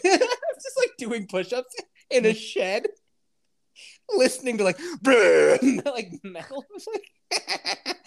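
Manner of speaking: high, breathless laughing fit; can barely talk for laughing. Real, not acted. Laughing fit about push-ups.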